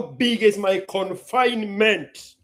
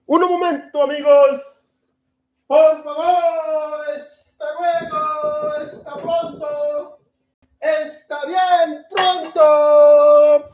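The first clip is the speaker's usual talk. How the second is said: higher, slow hammed-up Spanish; playful Spanish; 9 semitones higher, 8 dB louder, slower than usual. Telling his audience to wait, slow, high and sung-out. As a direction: ham it up like a showman.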